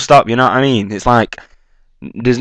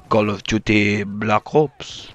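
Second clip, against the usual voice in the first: slow, softer mock French accent; bad French accent; 3 semitones lower, 7 dB quieter, much slower than usual. Shown as a demonstration. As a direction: imitate a French accent, quietly and drawn out. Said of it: "trying to do bad accents".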